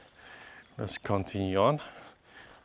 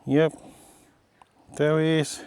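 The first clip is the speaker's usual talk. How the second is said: excited; 8 semitones higher, 5 dB louder than usual. Real, not acted. Greeting the dog.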